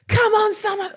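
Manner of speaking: high, loud imitation of a singer; [name] impression. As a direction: hype it up like a pop star on stage. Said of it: "I can't even do it".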